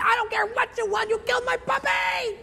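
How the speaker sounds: very high accusing wail; hammed-up fury. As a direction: wail the accusation like a distraught owner. Owner cuts off the excuse.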